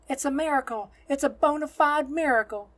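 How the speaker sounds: high, loud jubilant cry; amazed shout. A narrator's reading. Nun proclaims the children's healing to the crowd, amazed shout. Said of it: "the nun shouts".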